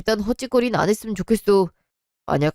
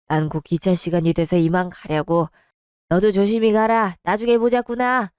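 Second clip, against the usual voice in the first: ordinary talk, nothing marked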